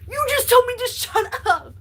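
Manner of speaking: can't believe he said that; indignant disbelief. Retold or imitated. Confronting a friend who told her to shut up, shocked and loud.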